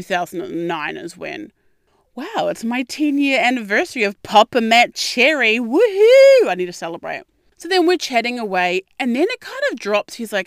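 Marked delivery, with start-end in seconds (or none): 5.6-6.5 high, loud celebratory whoop; a loud, high woohoo of celebration; excited whoop. Real, not acted. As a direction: cheer it out like a party. Celebrating her ten-year anniversary.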